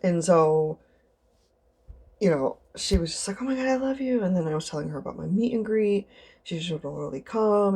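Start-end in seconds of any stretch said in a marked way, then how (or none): 3.4-4.2 high-pitched imitated fan gush; mock fangirl delight. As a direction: mimic an adoring fan, voice lifted high. Imitates the same adoring fan.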